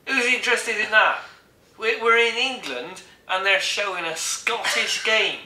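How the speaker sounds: high-pitched comic outrage; indignant rant. Acted. Comic outrage at wrong match.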